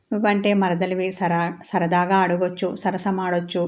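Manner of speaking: plain reading voice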